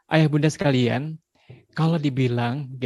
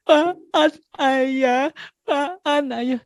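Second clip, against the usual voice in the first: put-on much higher little-kid voice; small child; 12 semitones higher, much slower than usual. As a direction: answer like a toddler eager to please. Small child answering father. (suara anak kecil menjawab ayahnya)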